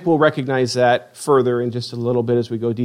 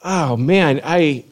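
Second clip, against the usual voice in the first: normal talking voice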